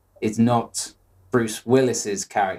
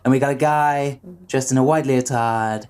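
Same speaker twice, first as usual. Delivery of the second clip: presidential parody as a contest host; Donald Trump impression; 2 semitones higher than usual. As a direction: hype the contest like the parodied politician. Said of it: "That's my Donald Trump impression".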